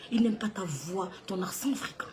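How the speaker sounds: hushed whisper, like sharing gossip; stage-whispered gossip; whispered aside. Acted. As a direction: whisper it as a secret. Secret dropped in a whisper.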